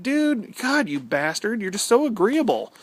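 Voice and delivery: teasing complaint, hammed-up; mock exasperation. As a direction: rant in play-exasperation. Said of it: "And I'm like".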